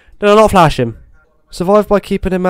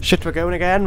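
ordinary talk

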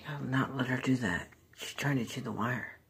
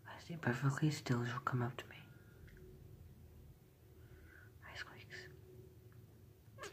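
whispering; 12 dB quieter than usual. Real, not acted. Sneaking up on pet.

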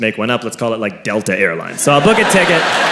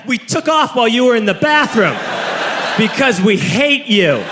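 slow, higher mock-villain staff voice; hammed-up spiteful airline agent; cruel taunting; 5 semitones higher, slower than usual. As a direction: draw out each jab at the passenger.

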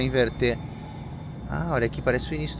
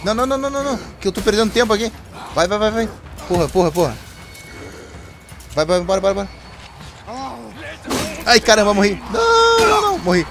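high, loud frantic panic, yelling; panicking gamer; 7 semitones higher, 5 dB louder, faster than usual. Real, not acted. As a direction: scream it as the monster catches you. Chased by monster, dies in game.

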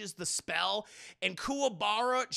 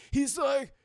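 ordinary delivery, nothing marked

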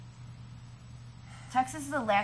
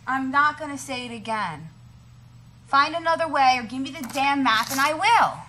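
raised, higher angry ultimatum; 6 semitones higher, 6 dB louder than usual. Acted. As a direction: lay down the law, furious. Refusing to go through Texas.

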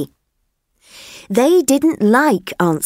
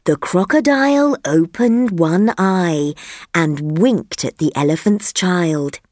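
ordinary delivery, nothing marked

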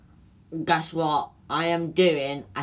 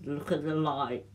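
usual speaking voice

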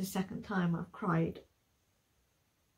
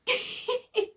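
breathy giggle; 13 semitones higher, 4 dB louder than usual. Real, not acted. Overwhelmed while reading a book.

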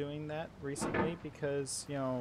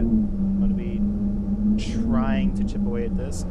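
hyped intro; 10 semitones higher, 16 dB louder than usual. Real, not acted. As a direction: pump up the start. Excited high intro.

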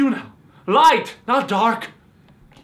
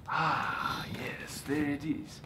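slow, soft whisper; 5 semitones lower, 12 dB quieter, slower than usual. Acted. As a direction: hush it as if savouring the moment. Offers slice, hushed.

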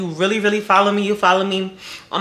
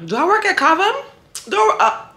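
excited; 13 semitones higher than usual. Real, not acted. Rave over a tasty bite.

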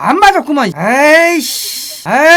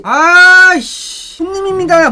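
normal talking voice

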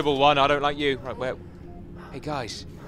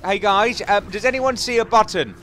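louder and higher call to the team; 6 semitones higher, 6 dB louder than usual. Real, not acted. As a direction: raise your voice so the squad hears. Repeats question to team louder.

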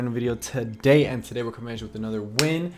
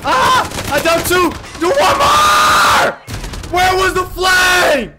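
very high, full-volume screaming; frantic yelling; 11 dB louder, much slower than usual. Real, not acted. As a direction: scream at teammates, words stretched out. Yells at team mid-fight.

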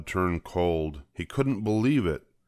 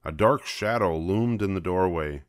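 ordinary delivery, nothing marked

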